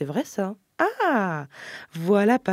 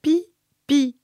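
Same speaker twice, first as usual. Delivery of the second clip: high, louder sing-song "Pipi"; a sister urging the toddler, high and coaxing; big sister coaxing; 8 semitones higher, 5 dB louder than usual. Acted. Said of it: "qu'elle répète".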